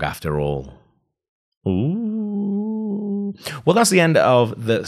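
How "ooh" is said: much higher, a sung-out ooh; delighted ooh. Real, not acted. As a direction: swoon at the cliffhanger. Reacting at chapter end.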